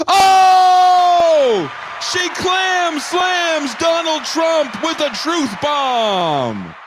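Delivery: high, full-blast play-by-play holler; yelled fight commentary. Acted. Commentator calling the debate clam slam, shouted UFC style. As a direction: build to a stretched, screamed knockout call. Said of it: "words that I've just yelled into this microphone".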